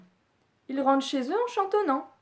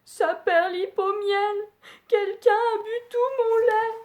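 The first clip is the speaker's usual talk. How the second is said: little bear whimpering; 10 semitones higher, 4 dB louder than usual. Acted. Little Bear upset over his empty bowl, high whimpering. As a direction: whine it like a heartbroken cub. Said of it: "se désole".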